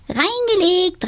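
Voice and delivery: very high, triumphant witch cry; gleeful call. A narrator's reading. Donatella fooling Tobias, gleeful very high cry. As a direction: crow with glee. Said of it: "und rief".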